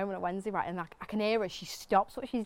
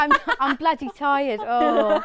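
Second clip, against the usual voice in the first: louder, slow, put-on Southern English accent; mock Southern English, higher and louder; mimicked Southern accent; 6 semitones higher, 9 dB louder, much slower than usual. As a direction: exaggerate a Southern accent, drawn out. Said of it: "even when I'm around Southerners".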